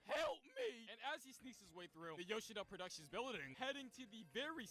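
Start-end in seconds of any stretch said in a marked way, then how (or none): 0.1-0.9 higher, louder cry for help; hammed-up panicked plea; mock panic. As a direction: yelp it like a scared villain. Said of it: "just panicking, going".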